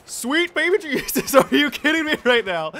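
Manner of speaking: high, loud disbelieving cry; amazed shout. Real, not acted. Amazed reaction to a kill.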